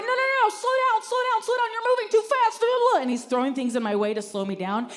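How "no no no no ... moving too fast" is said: high, panicked pleading; panicked guy. Retold or imitated. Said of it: "He's going".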